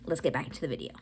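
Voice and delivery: upbeat voiceover catching viewers up; voiceover recap. Real, not acted.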